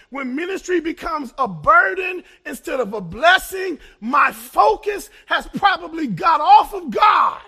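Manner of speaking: loud, rising preacher's cry; full-force preaching with climbing pitch. Acted. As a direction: drive it home from the pulpit. Preacher builds a point.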